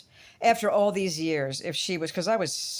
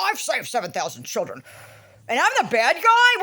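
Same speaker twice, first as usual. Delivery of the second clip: a hint of a huffy man, the last line higher and louder; mock indignant Tim; 4 semitones higher, 4 dB louder than usual. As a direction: parody his wounded outrage, building up. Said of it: "huffing and puffing".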